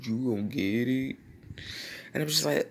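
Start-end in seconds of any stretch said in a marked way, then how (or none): 0.0-1.2 long drawn-out teasing "ooh"; sing-song mock warning; taunting sibling. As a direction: imitate a brother gloating at trouble. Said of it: "sibling that irks their last nerve".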